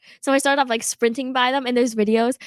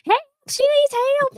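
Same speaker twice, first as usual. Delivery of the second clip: retold scream; 14 semitones higher, 4 dB louder than usual. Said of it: "I hear someone screaming".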